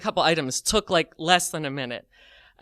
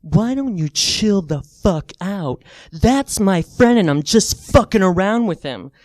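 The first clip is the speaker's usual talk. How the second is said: loud, slow imitated threat; confrontational mock anger; 7 dB louder, slower than usual. Man in the teller's face defending his joke, imitated angry rebuke. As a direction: lean on each word, loud and irate.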